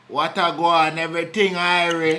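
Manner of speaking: put-on Jamaican patois greeting; Jamaican accent. Greeting a passer-by in a Jamaican accent. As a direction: hail him in a Jamaican accent. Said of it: "You just did that accent".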